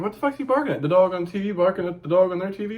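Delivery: high, incredulous retold outburst; baffled that the dog barks at the TV; exasperated disbelief. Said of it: "I'm like".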